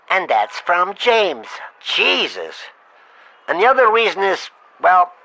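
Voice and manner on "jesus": normal talking voice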